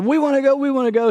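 put-on high-pitched, eager kid's pleading; eager boyish plea. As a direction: beg to go like an excited boy. Acted boys begging to go swimming.

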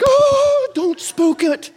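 high, loud mock alarm; hammed-up panic not to scare them off; mock frantic warning; excited hush. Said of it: "we're like".

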